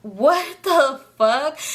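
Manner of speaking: slow, drawn-out shocked curse; stunned what the fuck. Retold or imitated. Staring at the wrecked Uber, slow stunned disbelief. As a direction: let it stretch out in disbelief. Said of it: "and I'm just like".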